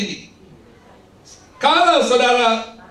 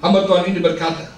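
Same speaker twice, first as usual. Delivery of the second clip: ordinary delivery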